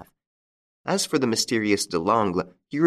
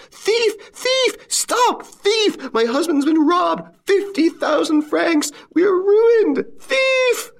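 frantic, very high screaming; absolute yells; 6 dB louder, much slower than usual. A narrator's reading. Robbed wife raising the alarm at the racecourse, screaming. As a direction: yell for help at piercing pitch. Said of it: "She uttered absolute yells".

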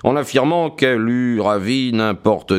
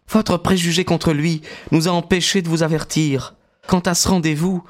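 higher, Maud reproaching her father; lightly voiced young woman; 8 semitones higher than usual. A narrator's reading. Maud blaming her father's prejudice, lightly voiced young woman. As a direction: reproach him evenly. Maud's voice on retake.

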